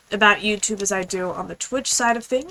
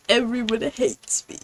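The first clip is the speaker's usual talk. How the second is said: mock self-pity. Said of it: "I'm like".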